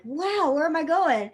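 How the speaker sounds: excited reaction, pitch lifted; excited retold question. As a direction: act out an eager "where to?". Retells excited reply to outing.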